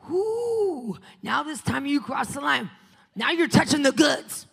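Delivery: high, loud scolding shout at Satan; loud mock outrage; mock angry warning. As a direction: tell Satan off with a yell. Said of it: "I was like".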